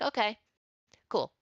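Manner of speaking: high, bright mock-cheery okay cool; sarcastic okay. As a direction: brush her off with fake cheer. Sarcastic reply to teacher.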